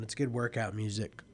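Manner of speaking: ordinary talk, nothing marked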